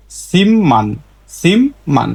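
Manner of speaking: সিমমান with a double m, no p; nasalised sound change. Shown as a demonstration. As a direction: model সিমমান syllable by syllable with m. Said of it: "তাহলে উচ্চারণটা হবে এরকম". (সিমমান, জোড়া ম দিয়ে)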